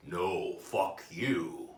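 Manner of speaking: very slow, drawn-out retort; deliberate comeback. Acted. Therapist turning it back on his patient, deliberate comeback. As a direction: stretch each word out with authority.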